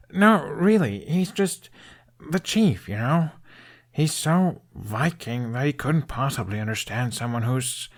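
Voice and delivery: a hint of young Hiccup protesting earnestly; Hiccup insisting. Hiccup insists to Toothless that his dad is fine.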